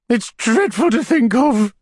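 high, slow falsetto horror; shuddering lady. A narrator's reading. Lady tells the beadle of her horror, shuddering. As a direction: recoil from the thought in a high voice. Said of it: "said the lady, shuddering".

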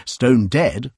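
ordinary delivery, nothing marked

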